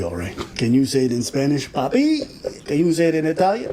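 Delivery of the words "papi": teasing papi. Pretend. Mock Spanish daddy call.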